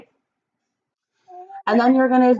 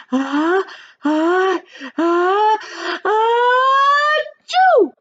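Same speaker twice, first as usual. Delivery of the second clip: building sneeze; 11 semitones higher, 4 dB louder, much slower than usual. Acted. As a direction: sneeze that climbs higher and louder to the burst. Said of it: "Andrew went".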